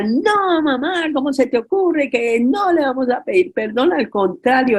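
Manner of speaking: high-pitched defiant protest to mother; rebellious retort. Retold or imitated. Daughter defiantly refusing her mother's order to apologize. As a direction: push back hard, like the rebel daughter. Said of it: "yo era de las más rebeldes".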